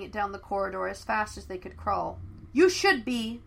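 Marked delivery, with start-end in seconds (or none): 2.5-3.4 loud indignant call after them; shouted. Acted. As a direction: yell it at the retreating intruders. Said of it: "shouted the head cook".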